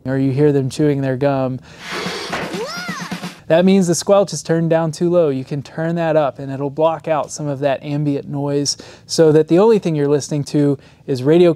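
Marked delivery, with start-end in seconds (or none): none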